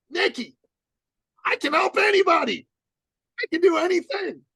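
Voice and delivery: high, loud overeager voice; mimicking Dan's high boastful shout; eager boastful client. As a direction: act out the overconfident client shouting his pitch. Dan boasting, voiced by speaker.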